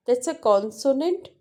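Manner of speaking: ordinary delivery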